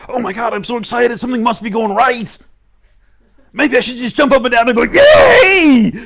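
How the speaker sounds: very high, loud glee building to a cheer; excited. Acted. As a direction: turn the panic into whooping delight. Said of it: "You can say".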